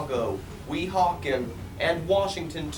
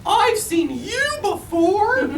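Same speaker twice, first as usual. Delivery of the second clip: gasping Maisie; 5 dB louder than usual. A narrator's reading. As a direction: stretch the gasp out high and loud. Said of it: "gasped Maisie".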